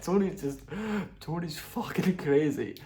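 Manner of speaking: giggling through the words, slowed; laughing. Real, not acted. Half-laughed disbelief. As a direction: vlogger laughing helplessly about Tony Ferguson. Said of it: "That was so funny".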